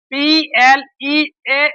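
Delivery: slow spelling. Shown as a demonstration. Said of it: "यहाँ भी क्या है".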